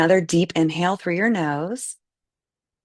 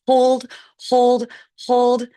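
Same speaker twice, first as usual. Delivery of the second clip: louder and higher, punchy repeated hold; firm hold cue; 7 semitones higher, 6 dB louder, slower than usual. Acted. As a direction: push them to keep holding tight. Cueing the body tension hold.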